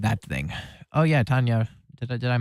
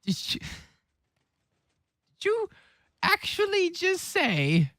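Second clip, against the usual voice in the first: shocked disbelief. Real, not acted.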